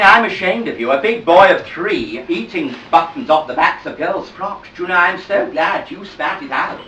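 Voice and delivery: sweetly patient teacher coaxing toddlers; nursery school teacher. Acted. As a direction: talk to the little ones like a kind, firm nursery teacher.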